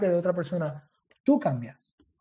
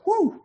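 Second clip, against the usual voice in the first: surprised whoop; 14 semitones higher than usual. Real, not acted. Surprise at own miscount.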